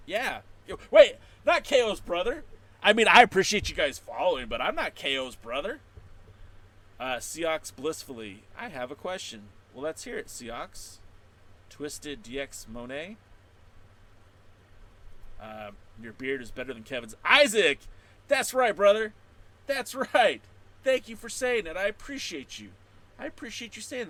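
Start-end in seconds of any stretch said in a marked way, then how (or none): 0.7-5.8 high, loud protest; emphatic correction. Real, not acted. Streamer denying being KO's brother, emphatic. 17.2-24.1 high, loud thrilled thanks; grateful hype. Real, not acted. Streamer thanking a viewer for praising his beard, hyped.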